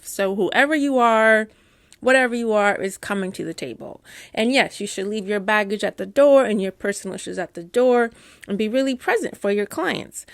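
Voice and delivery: ordinary talk, nothing marked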